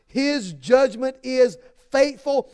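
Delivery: a slow, ringing declaration; emphatic proclamation. Real, not acted. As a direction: proclaim it slowly to the whole church. Preacher's climactic proclamation.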